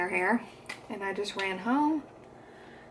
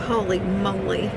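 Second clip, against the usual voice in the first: ordinary talk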